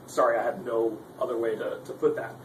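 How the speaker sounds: ordinary talk, nothing marked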